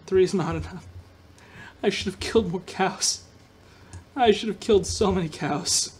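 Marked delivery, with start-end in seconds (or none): none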